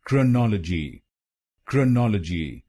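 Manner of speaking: क्रोनोलॉजी as krə-NO-lə-ji, schwa in first syllable; correct pronunciation. Shown as a demonstration. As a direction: model क्रोनोलॉजी clearly: krə-nɔl-ə-ji. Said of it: "तो बनेगा".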